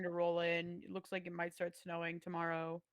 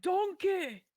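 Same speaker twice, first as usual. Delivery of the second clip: put-on Scottish accent; 10 semitones higher, 7 dB louder than usual. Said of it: "I can't do a Scottish accent".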